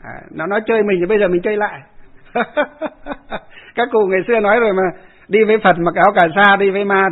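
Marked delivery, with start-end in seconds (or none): 2.3-3.4 high bright burst of laughter; short laugh. Real, not acted. Monk laughing as he recalls tricking the spirit. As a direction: chuckle at his own cleverness.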